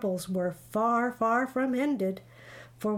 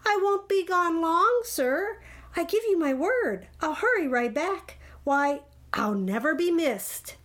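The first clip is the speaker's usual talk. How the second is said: high, insistent pleading; wheedling storybook bird; begging; 6 semitones higher, 3 dB louder than usual. A narrator's reading. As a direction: plead and promise in a raised voice. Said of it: "begged the bird".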